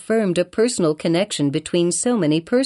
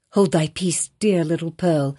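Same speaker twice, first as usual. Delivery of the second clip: plain reading voice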